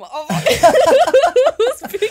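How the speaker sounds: helpless burst of laughter through the words; laughing. Real, not acted. Co-host owning up to the mistake from the start, laughing loudly. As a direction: break into a loud, high laugh mid-sentence.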